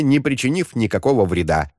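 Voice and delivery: ordinary delivery, nothing marked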